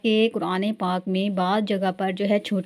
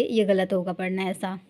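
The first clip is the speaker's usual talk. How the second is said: usual speaking voice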